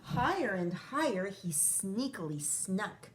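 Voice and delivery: ordinary talk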